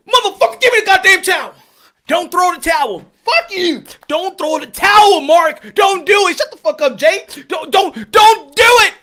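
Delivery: high, loud corner argument; mock furious shouting. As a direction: yell it like a brawl in the corner. Acted corner shouting match.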